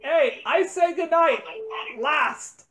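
ordinary talk, nothing marked

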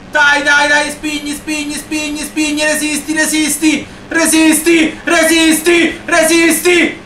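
high, frantic cheering on; excited urging. Real, not acted. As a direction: urge them to hold on, pitched high. Last lap, urging rider to hold.

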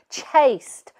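"Chased" repeated high and firm; firm insistence. A narrator's reading. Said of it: "repeated Mum".

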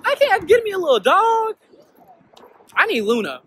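high, loud and excited. Real, not acted. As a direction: burst out excited. Excited about dog.

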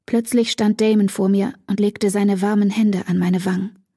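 ordinary delivery, nothing marked

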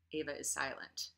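ordinary delivery

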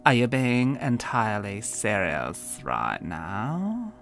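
put-on mean girl hammering each word at the new girl; slow, emphatic. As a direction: spell out each word in disbelief. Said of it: "Christelle spoke slowly, emphasizing every word".

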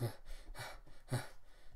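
breathy, voiceless panting; acted panting. Narrator voices Sophia panting before speaking, acted panting. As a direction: pant in short, soft puffs of breath. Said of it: "She's panting, goes".

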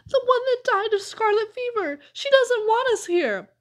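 high, wailing dread; panicky, moaning warning; frightened moan. A narrator's reading. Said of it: "moaned Amos, finding his voice at last".

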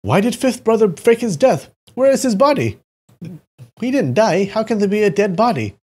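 high, loud, excited questions; elated questioning. A narrator's reading. Brothers elated at the faked death, asking excitedly. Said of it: "All of them asked in quick succession".

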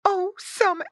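high, loud wail from mama pig; panicked high pig voice; alarmed cry. A narrator's reading. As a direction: cry out in horror as a mother pig. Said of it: "cried Missus Pig".

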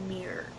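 "mirror" swallowed into one syllable, "meer"; unfinished-word imitation. Teasing American friend over "mirror", imitated unfinished pronunciation. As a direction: say "mirror" as "mirr", dropping the ending. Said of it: "You don't even finish the word".

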